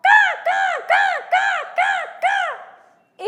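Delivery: loud, high imitated crow caws; crow cawing. Crow finding her true love, loud high cawing. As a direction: caw out sharply, over and over. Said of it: "soon she found Mister Craw".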